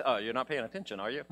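a hint of a booming God; voice from heaven. Preacher imitating God scolding a dozer, mock stern. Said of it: "the voice from heaven says".

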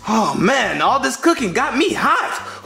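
ordinary delivery

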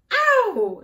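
sudden high, loud yelp; loud storybook ow; pained cry. A narrator's reading. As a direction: yelp as if you just hit something hard. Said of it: "she cried".

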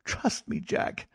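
hushed, high, desperate plea; pleading cry. A narrator's reading. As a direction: implore him softly and urgently. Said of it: "she cried".

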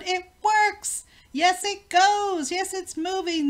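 high, loud cheering; excited cries. A narrator's reading. Everyone cheers as the machine finally runs, excited. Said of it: "they all cried".

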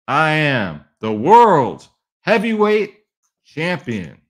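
slow, loud, high title proclamation; mock-grand, drawn-out brag; boasting champion. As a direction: proclaim it like a showboating wrestling champ.

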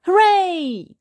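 very high, loud joyful shout; excited cheer. A narrator's reading. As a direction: cheer like a thrilled kid. Henry cheers about camping.

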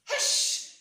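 sharp unvoiced hush; loud hush. A narrator's reading. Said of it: "said the squirrel and the fox and the badger and the hare".

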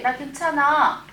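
normal talking voice

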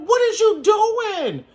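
high, slow stunned question; retold shocked outburst. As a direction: blurt it out in disbelief. Retelling a shocked confrontation.

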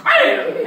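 loud high yelled bam; laughing mock slam sound; phone slam. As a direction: shout it like slamming the phone. Slamming down the phone.

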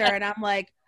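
normal talking voice

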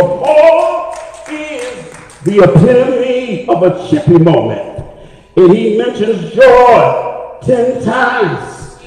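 loud, drawn-out climactic preaching with punched phrases; full-force preaching. Real, not acted. Preacher rousing congregation to praise despite death, full-force preaching.

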